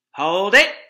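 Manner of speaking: loud sudden halt; stop command. Acted. Fox halting his digging family, loud. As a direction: stop them sharply. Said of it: "he said".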